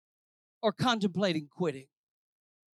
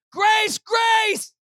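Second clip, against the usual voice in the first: high, ringing cry; full-force shout; 11 dB louder than usual. Acted. Preacher acting out the shout around the building, full shout. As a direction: shout each word out long and loud. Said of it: "and he shouted".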